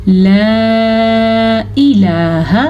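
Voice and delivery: لا الہ with the madd held about two seconds; long madd. Shown as a demonstration. Teacher demonstrating the long madd in لا الہ. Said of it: "مد کا دھیان رکھنا ہے".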